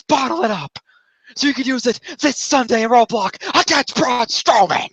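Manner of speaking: high-pitched mock pep talk, rushing then pressing; rally him into the dark side; intense speech. Foley pushes Sami toward his dark side, high and intense. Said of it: "he screamed this at Foley too".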